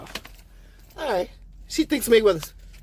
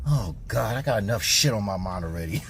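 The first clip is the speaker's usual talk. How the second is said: voicing a stressed stranger's thoughts, lower; lower, annoyed inner voice; 6 semitones lower, 3 dB quieter than usual. Imitating annoyed Brooklyn strangers sizing him up, lower voice. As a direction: grumble it like a busy passerby. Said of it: "You can see in their face".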